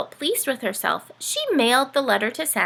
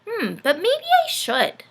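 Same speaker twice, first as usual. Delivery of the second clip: put-on high, musing child voice; thoughtful; 13 semitones higher, 4 dB louder than usual. Dorothy deciding to write a letter, thoughtful. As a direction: talk yourself into it. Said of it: "Dorothy thoughtfully".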